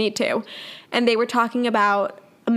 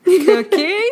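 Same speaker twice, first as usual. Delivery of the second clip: mom trying the password; 7 semitones higher, 6 dB louder, much slower than usual. Retold or imitated. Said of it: "My mom would be like".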